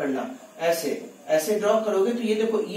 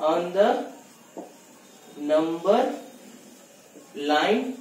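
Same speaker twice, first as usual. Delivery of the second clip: slow dictation; much slower than usual. Shown as a demonstration. Teacher dictating the question.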